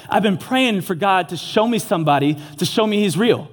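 ordinary delivery

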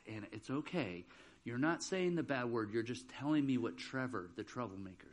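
put-on low, soft coaxing of a child; quiet reassurance. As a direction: imitate a teacher gently reassuring. Teacher reassuring reluctant child.

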